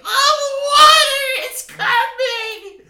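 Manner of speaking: very high, loud hammed-up wail; imitated bawling scream. Little sister as the flood comes in, mock screaming. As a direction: scream it like a panicking little kid. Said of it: "bawling and screaming".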